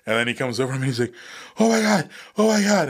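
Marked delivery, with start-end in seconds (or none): 1.6-2.9 high, louder frantic repeat; panicked oh my God. Retold or imitated. Soldier seeing his friend's legs re-break, frantic high cry. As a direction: blurt it over and over in alarm. Buddy sees him fall, panicked.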